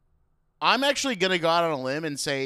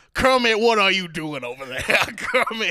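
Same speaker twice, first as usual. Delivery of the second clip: louder, higher mock Orson Welles; 6 semitones higher, 8 dB louder than usual. Host mocking Orson Welles calling Kermit, loud and hammy. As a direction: boom out a hammed-up Orson Welles. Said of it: "This is how he sounds".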